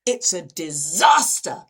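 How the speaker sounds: loud, drawn-out mock catastrophe; slow, hammed-up dismay; really dramatic friend. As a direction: imitate a drama queen, leaning hard on the key word. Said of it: "Really dramatic".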